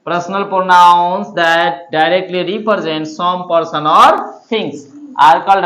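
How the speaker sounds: very slow, word by word recitation. Shown as a demonstration. Teacher reciting the definition slowly so students memorise it. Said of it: "एक बार फिर से याद कर लिया जाए".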